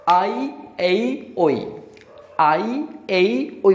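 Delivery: आई, ए, ओई drawn out very slowly, each glide stretched; slow diphthong drill. Shown as a demonstration. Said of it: "बहुत ही easily आप याद रख सकते हैं". (धीरे-धीरे खींचकर आई, ए, ओई)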